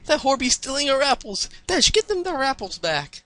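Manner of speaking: high-pitched, hammed-up country hollering; alarmed outcry. A narrator's reading. Applejack orders Dash after the thief, alarmed outcry. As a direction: call out urgently in a Southern accent.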